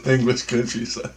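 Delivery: ordinary delivery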